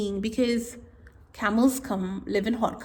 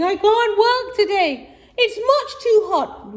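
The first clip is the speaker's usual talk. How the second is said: moaning camel; 11 semitones higher, 6 dB louder than usual. Acted. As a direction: moan like a spoilt camel. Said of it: "Calamity's over here saying".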